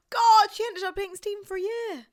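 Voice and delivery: high, loud gasp of disbelief; mock shock. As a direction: gasp and exclaim as if scandalised. Mock shock at friend's story.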